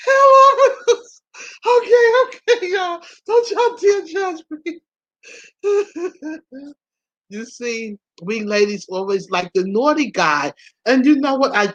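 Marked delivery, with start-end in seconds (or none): 0.1-6.7 high, squealing words through real laughter; cracking up, voice way up high; helpless laughter. Real, not acted. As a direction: laugh so hard it nearly turns to crying. Cracking up over her choice.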